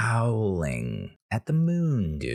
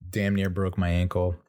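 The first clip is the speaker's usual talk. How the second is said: ordinary delivery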